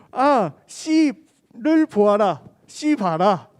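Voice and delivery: loud, very slow Gyeongsang dialect call; loud Gyeongsang exclamation. Retold or imitated. Girl holding up a watermelon to the group, slow loud Gyeongsang dialect. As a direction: stretch out the Gyeongsang dialect words, showing the watermelon. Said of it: "이렇게 얘기한 거예요".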